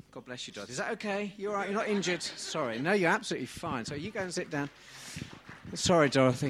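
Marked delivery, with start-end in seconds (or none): none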